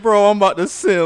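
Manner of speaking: high, loud mock simp voice; hyped simp voice. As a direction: squeal it like a lovestruck fan. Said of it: "He said".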